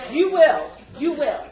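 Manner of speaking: high, loud laughing insistence; laughing tease. Retold or imitated. She teases her boyfriend that he will, high loud laughing tease. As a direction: tease him while laughing. Teasing reply in story.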